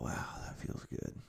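breathy whispered sigh of relief. Real, not acted. Vlogger savours a deep breath with viewers, whispered. Exhaling during breathing exercise.

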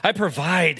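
plain reading voice

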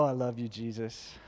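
normal talking voice

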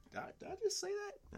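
high squeaky self-surprise; surprised at himself. Real, not acted. Embarrassed host catching his own slip, startled.

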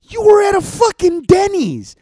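loud, high, furious tirade at whiny customers; furious rant. Retold or imitated. Ranting at entitled Yelp reviewers, loud furious shouting. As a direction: let it rip, voice cracking with outrage.

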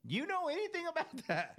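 high teasing question; pry with fake innocence; mock suspicious. Mock interrogation continues.

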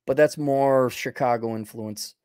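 ordinary talk, nothing marked

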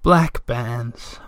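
drawn out, as if surfacing from sleep; Moomin Mama. A narrator's reading. Mother answering her son's whisper from winter sleep, lightly voiced. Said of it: "Moomin Mama answered, from the depths".

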